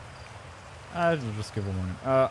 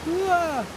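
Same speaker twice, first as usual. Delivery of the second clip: sudden high yelp to scare; jump-scare the listener with a yell; mock scare shout; 4 dB louder than usual. Storyteller yelling at the listener's scary moment.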